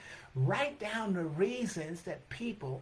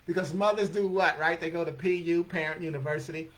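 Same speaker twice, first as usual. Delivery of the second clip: ordinary delivery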